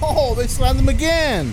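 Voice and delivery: high, loud and slow, genuinely stunned; shocked outburst. Real, not acted. Viewer watching the arrest video, shocked and loud. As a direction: blurt it out in disbelief. Reacting to officers slamming man.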